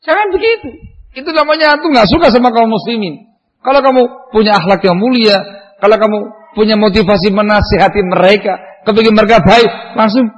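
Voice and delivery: loud, high, insistent admonishing; forceful preaching. Acted. Preacher scolding his listeners for ignoring a crying child, loud and insistent. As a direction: press the point hard, as if from the pulpit.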